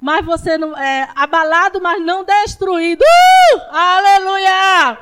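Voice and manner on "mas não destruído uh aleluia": rising to a joyful yell; triumphant preaching shout; ecstatic preacher cry. Real, not acted. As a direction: shout it high and loud, then a long hallelujah.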